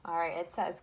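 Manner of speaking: ordinary talk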